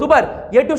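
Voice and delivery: ordinary talk, nothing marked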